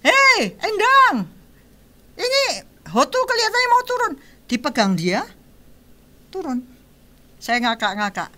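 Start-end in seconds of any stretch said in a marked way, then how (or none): 0.0-4.1 high, loud call to someone in the house; retold shouting. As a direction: shout out urgently in a raised pitch. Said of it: "Saya teriak-teriak".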